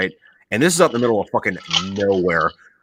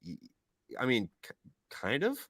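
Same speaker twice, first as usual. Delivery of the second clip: much softer, hesitant mumble; sheepish admission; 14 dB quieter than usual. Retold or imitated. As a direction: soldiers awkwardly admitting it. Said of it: "we're like".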